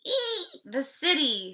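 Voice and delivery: very high squeal into the city; pig squealing. Acted. The old pig squeals to the others about the city. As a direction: squeal it out with glee. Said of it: "squeals the old pig".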